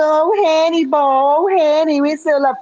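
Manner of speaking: high swooning mock fan voice; mocking adoring fan. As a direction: gush it like a lovestruck fan.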